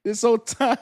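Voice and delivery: high, loud disbelief at his own mistake; laugh-choked whole time?; shocked it was backwards all along; stunned realization. Real, not acted.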